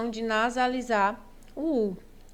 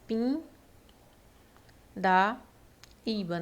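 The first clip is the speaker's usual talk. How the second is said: "pin", "da", "iba" parted by long pauses; syllable by syllable; much slower than usual. Shown as a demonstration. Teacher dividing the exam word, "pin da iba" said piece by piece. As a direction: isolate each piece, "pin", then "da", then "iba". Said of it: "a correta divisão silábica da palavra".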